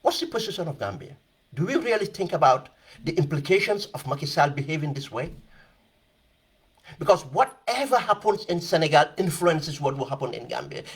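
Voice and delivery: ordinary talk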